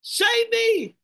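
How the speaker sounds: high, loud desperate plea; crying out to God. Acted. Preacher acting Peter crying out to the Lord. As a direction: cry out for rescue like a sinking man. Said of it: "doon na siya umiyak ng tawag sa Panginoon".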